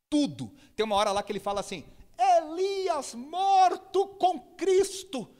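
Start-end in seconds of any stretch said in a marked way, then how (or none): 2.2-5.3 imitated preacher cry, high, loud and drawn out; mock pulpit proclamation; preacher's intonation. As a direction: stretch it slowly like a fervent radio preacher. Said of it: "ele faz uma entonação de pregador".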